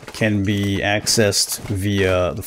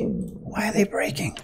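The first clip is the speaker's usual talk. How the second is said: whisper; 13 semitones higher, 7 dB quieter than usual. Real, not acted. Lines keep snapping.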